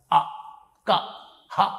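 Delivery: అ, క, హ said separately from the throat; throat sounds. Shown as a demonstration. Guru shows the student where letters are formed, demonstrated throat sounds. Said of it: "ఇక్కడి నుంచి పలకాలి".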